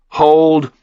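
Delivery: ordinary delivery, nothing marked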